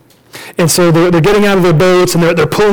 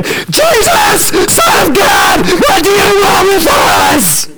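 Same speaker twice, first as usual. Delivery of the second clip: very high, harsh full scream; madman screaming; 13 semitones higher, much slower than usual. Acted. Demoniac screaming at Jesus as he charges up, mad shriek. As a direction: scream it out like one possessed. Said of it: "like a madman screaming".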